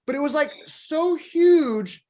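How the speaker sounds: awed emphasis. Real, not acted. Marvelling at the biggest pit.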